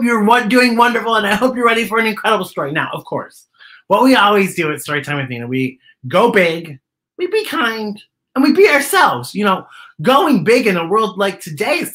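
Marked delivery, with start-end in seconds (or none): none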